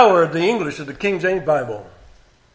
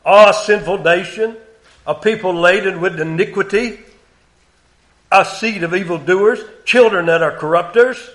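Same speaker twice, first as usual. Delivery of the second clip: raised, deliberate King James reading; solemn scripture reading; 3 semitones higher, 4 dB louder than usual. Acted. Preacher reading God's words to congregation, raised. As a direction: read it as God's complaint against Israel.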